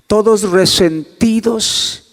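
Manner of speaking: ordinary talk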